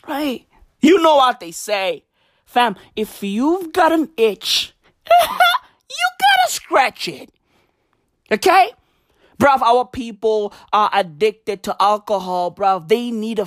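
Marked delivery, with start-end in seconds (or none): 5.1-7.3 high, slow squeal; exaggerated punchline. Comedian completing a saying about an itch, high squealed punchline. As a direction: squeal it out slowly.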